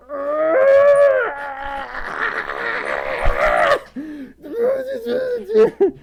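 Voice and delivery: high, loud shrieking laughter. Real, not acted. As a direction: burst into a squealing laugh. Overwhelmed by donation.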